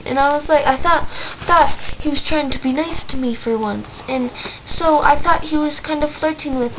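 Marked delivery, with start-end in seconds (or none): none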